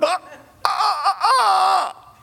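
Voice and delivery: high, mock-panicked cries of ah; panicked wails. Will Smith seeing the alien invasion, wailing. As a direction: wail at the alien invasion overhead.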